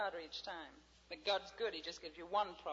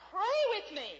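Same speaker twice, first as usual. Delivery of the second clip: high and loud plea; preacher urging the congregation; emphatic invitation; 13 semitones higher, 15 dB louder than usual. Retold or imitated. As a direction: urge it out loud. Quoted invitation.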